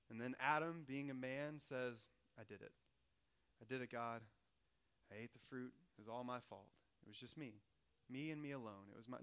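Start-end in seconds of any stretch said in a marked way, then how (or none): 2.4-9.2 low, soft, contrite confession; mock humble confession. Pastor mocks Adam taking the blame, low and soft. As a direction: hang your head and own it, softly.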